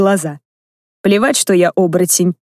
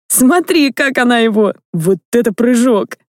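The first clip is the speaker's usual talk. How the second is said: giggling, high and excited; laughing child; 4 semitones higher than usual. Acted. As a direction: laugh through the words like a delighted boy. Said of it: "хохотал Рональд".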